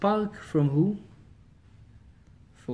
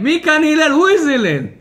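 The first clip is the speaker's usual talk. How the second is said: loud, high mock yell at the window; rude shout; 10 semitones higher, 8 dB louder than usual. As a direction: bellow rudely for the rabbi. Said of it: "And then he comes again".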